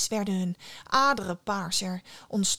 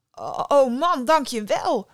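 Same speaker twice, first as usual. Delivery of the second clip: higher, louder burst of thanks; relieved exclamation; 5 semitones higher, 5 dB louder than usual. A narrator's reading. Nera thanks the hanged man as the branch holds, exclaiming. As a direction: cry out your thanks. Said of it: "riep Nera uit".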